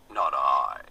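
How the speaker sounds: low, loud pig voice refusing; gruff pig. A narrator's reading. The lazy pig refusing the hen, low and gruff.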